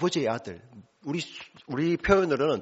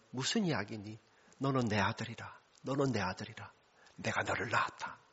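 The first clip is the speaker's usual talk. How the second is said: tender father; 2 semitones lower, 9 dB quieter than usual. Acted. Father tenderly embraces returning son.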